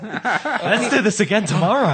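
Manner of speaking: high, slow fake-happy doll; sing-song like a possessed toy; psychotic doll voice. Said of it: "Fake psychotic doll".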